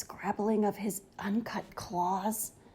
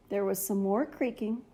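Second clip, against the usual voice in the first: ordinary delivery, nothing marked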